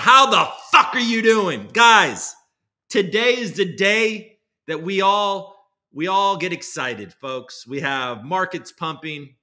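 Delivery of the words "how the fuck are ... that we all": loud, higher hammed-up host greeting; mock host shout. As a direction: belt it out in a put-on host voice. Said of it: "And then I'm like".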